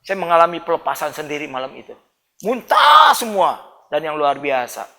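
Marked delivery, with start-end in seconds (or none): 2.4-3.6 slow, loud, strained cry; half-acted yell of relief; retold shout. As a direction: shout it out as the purge hits. Recalls vomiting everything out.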